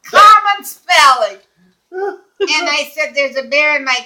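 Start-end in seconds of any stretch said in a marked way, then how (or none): 0.0-1.4 loud, slow and higher; emphatic retort. Retold or imitated. Caller giving dispatcher the spelling, slow emphatic retort. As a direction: punch out both words slowly, exasperated. Retold curt answer to dispatcher.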